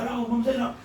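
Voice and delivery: ordinary talk, nothing marked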